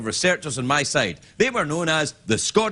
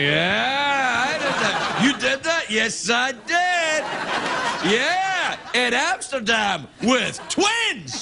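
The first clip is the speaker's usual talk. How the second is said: gleeful boasting; 7 semitones higher, much slower than usual. Retold or imitated. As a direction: brag it with glee. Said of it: "I'm like".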